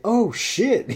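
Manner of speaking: high, loud burst of recognition; surprised shout. Retold or imitated. Drummer spotting his old friend, a loud surprised cry. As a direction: blurt it out when you spot him. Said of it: "he's like".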